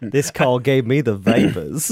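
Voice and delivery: slow, laughing, fainting-lady Southern drawl; mock Southern belle. Mocking the Southern accent.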